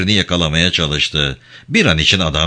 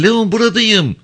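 shouting. Acted. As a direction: make sure he hears you over the fire. Shouting down from burning deck.

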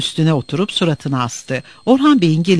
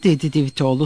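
ordinary delivery

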